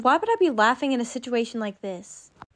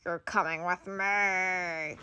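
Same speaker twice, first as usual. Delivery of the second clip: slow, much softer menacing monster voice; monster threat; 4 semitones lower, 8 dB quieter, slower than usual. Acted. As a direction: growl the threat quietly as you grab her. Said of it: "it's a monster".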